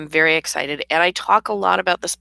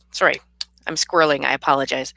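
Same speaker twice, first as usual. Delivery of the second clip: ordinary delivery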